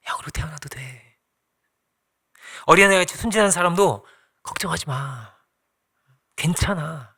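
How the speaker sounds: breathy reassuring whisper. Pretend. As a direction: whisper it gently like a comfort. 하나님이 위로하는 말. (부드럽게 속삭이며 위로하듯)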